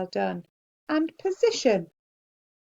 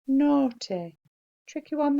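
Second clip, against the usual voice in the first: usual speaking voice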